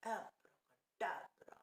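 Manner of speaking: very slow whispered chant; whispered spell. Acted. Witches cast spell on Pedro.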